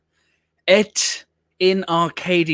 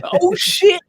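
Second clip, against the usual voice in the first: mock exclamation; 4 dB louder than usual. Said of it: "he's like".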